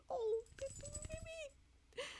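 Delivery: high cooing to a pet. Real, not acted. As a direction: coo softly at the cat, very high. Cooing at her cat.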